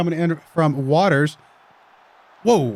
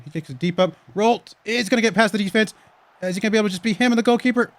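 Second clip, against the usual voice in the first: lively match commentary; play-by-play; 8 semitones higher, 3 dB louder than usual. Acted. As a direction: call the game as it happens.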